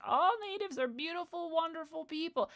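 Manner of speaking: high-pitched mock adoration; mocking gushing admirer. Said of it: "I hate how much people are like".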